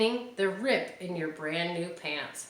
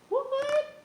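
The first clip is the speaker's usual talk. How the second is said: much higher, startled "What?"; mock disbelief, jumping way up in pitch; surprised. Real, not acted. Mom surprised by nightlight idea.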